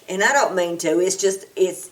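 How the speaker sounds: ordinary talk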